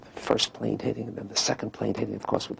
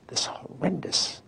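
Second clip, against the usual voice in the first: whispered mock drama; 6 semitones higher, slower than usual. Mocking the dramatic telling.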